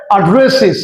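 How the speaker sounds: অ্যাড্রেসেস ending in "-siz", falling; new "-iz" ending. Shown as a demonstration. Teacher showing the corrected way, অ্যাড্রেসেস with "-siz". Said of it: "কি হবে?".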